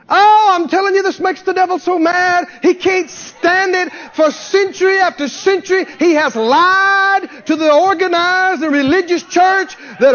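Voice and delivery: high, sustained revival cry; fired-up preaching. Real, not acted. Preacher exposing the devil's lies to the church, fired up. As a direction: ride high on the fervor, stretching key words. Preacher worked up after getting happy.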